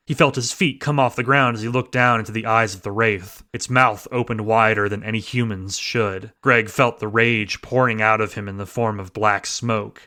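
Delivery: ordinary delivery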